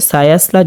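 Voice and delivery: quick সায়াসলা with a light, thin s like sin; spoken, unstretched সায়াসলা with a plain s; wrong thin S. Shown as a demonstration. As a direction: say সায়াসলা with light s and open a vowels. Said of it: "সিনের উচ্চারণ হয়ে যাবে".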